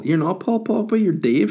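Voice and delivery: high, loud mock telling-off; exasperated scolding. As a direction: scold a deluded fool, hammed up. Acted scolding of app believer.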